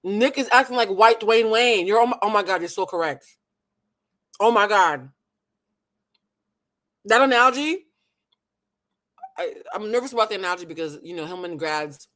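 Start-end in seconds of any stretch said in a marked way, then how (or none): 0.0-7.9 excited. Real, not acted. Excited reaction to a joke.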